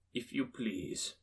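soft, slow, polite reply; discreet Scrooge. A narrator's reading. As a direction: keep it low-key and confidential. Scrooge confides his donation.

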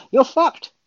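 high, loud blunt verdict; emphatic exclaim. Real, not acted. Pundit blurting his verdict on the pitch, loud. Punchline of sledgehammer rant.

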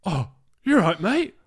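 fanboy rush, voice jumping high; starstruck fan greeting. Retold or imitated. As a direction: play it up like you've just spotted your idol. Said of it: "And I was like".

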